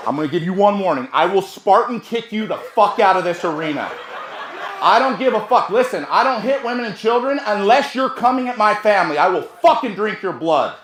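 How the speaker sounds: loud, forceful warning, building; retold angry threat. Husband warning his attacker's wife off, re-enacted loud threat. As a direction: act out laying down a furious warning.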